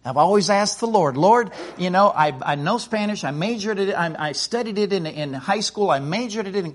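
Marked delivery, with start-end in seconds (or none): none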